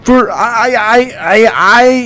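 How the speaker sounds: stammering, squeaky heir; Candace voice. Acted. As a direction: hero bluffing his authority, high. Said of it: "that's my impression".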